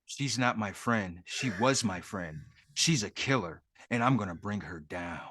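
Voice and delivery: low, soft hard-boiled cop voice; grim detective vow. Acted. Co-host voicing the cop about his killer friend, low mock-grave vow.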